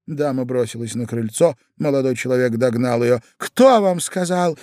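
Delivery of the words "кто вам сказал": lady's voice. A narrator's reading. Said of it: "спросила дама".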